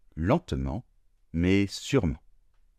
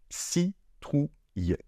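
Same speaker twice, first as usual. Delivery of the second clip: very slow; 7 semitones higher, much slower than usual. Shown as a demonstration. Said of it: "on n'entend pas de I".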